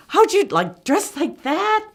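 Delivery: loud, high-pitched astonished question; incredulous. Retold or imitated. Traveler questioning the woman in short shorts, incredulous. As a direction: act out total disbelief, pitch soaring at the end.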